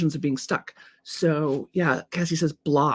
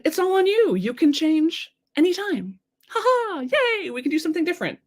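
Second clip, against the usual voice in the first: very high-pitched, singsong cheer; good-news delivery; 11 semitones higher than usual. Acted. Coach tells viewers the upside of having control, high gleeful good-news delivery. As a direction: give it as wonderful news, pitched way up. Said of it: "sort of a good news, bad news".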